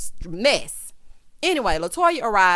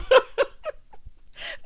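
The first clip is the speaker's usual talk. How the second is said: high, breathless cackle; real laughing. Real, not acted. Host cracks up at her prophet dot com joke, high laugh. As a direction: crack up at your own joke.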